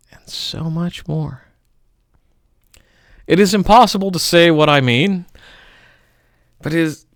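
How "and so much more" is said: soft unvoiced hush; whisper. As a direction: breathe the line out in a whisper. Recited poem, hushed line.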